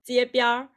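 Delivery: 街边儿 with the last syllable curled into an R; Beijing-style R colouring on the end of 街边儿; Beijing erhua. Shown as a demonstration. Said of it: "Beijing people would say".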